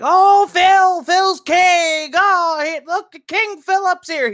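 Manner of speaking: high, loud fanfare for a king; mock adoring fans. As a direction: hail him in a high, hammed-up cheer. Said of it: "they're like".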